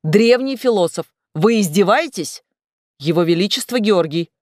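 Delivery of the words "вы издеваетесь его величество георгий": plain reading voice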